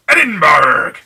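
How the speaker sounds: loud hammed-up Scottish "Edinburgh!", rolled like a pirate; a jokey pirate-ish Scottish accent; mock Scottish accent. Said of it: "Don't know what kind of accent that was. That was more pirate than Scottish".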